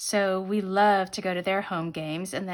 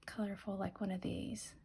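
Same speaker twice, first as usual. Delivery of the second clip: whispering; 13 dB quieter than usual. Real, not acted. Quietly picking stickers.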